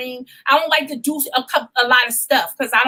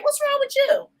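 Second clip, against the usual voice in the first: put-on girlfriend asking; 3 dB quieter than usual. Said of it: "Quiet's like".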